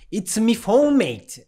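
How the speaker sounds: Irish accent, playful impression; mock Irish accent. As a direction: tease him with a jokey Irish accent. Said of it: "that's not my accent".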